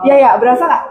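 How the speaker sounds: ordinary delivery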